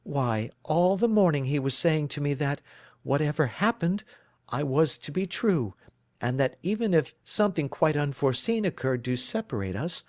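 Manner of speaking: a worried bride telling her story; anxious young woman. Acted.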